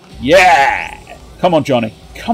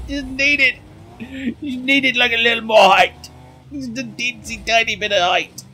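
squeaky little voice; 8 semitones higher, 4 dB quieter than usual. Pretend.

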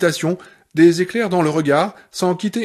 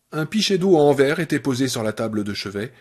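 ordinary delivery

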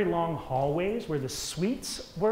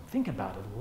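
ordinary talk, nothing marked

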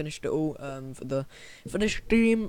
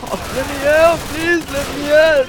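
high pleading whine; mock pleading; 9 semitones higher, 5 dB louder than usual. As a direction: beg to be released, hammed up.

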